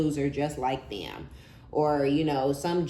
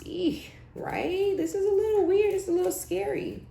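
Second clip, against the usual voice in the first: much higher, squeaky mock fright; playful fake fright; mock scared. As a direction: pretend to be spooked. Mock-scared reaction to the obsession.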